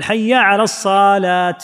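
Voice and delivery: "الصلاة" drawn out slowly to "as-saaalaat"; stretched saad. Shown as a demonstration. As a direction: hold the vowel after s in "الصلاة", end on t. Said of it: "فإذا مد الصاد". (إطالة الصاد: الصااالات)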